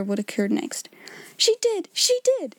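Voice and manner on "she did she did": high, insistent outburst; hysterical cry. A narrator's reading. Disappointed Miss Amelia defies her sister, hysterical high cry. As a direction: cry it out high, falling on each repeat. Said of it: "she cried".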